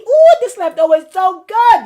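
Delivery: high, loud delighted ooh; hammed-up delight at leftovers; mock delight. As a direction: relish it out loud, over the top. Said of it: "tell yourself".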